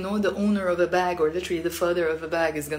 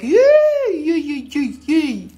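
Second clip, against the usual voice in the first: put-on very high, loud cooing to a baby; high-pitched playful baby-fussing; pampering a baby; 8 semitones higher, 7 dB louder, much slower than usual. Shown as a demonstration. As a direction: squeal it, then coo downward. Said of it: "if I wanna pamper a baby".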